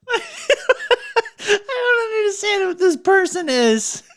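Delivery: real laughing fit; helpless laughter. Real, not acted. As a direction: struggle to speak while cracking up.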